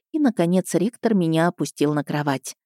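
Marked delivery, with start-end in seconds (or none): none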